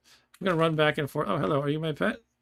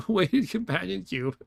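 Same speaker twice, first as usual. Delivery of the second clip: laughing repeat; 5 semitones higher, slower than usual. Real, not acted.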